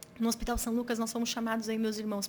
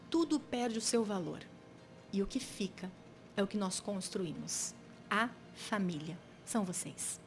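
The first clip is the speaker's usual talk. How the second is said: retold father's last words, measured and quieter; changed, solemn intonation; 3 semitones lower, 5 dB quieter than usual. Father in hospital speaks to his children, retold solemnly. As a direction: weigh each phrase, sinking lower and softer. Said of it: "ele mudou a entonação".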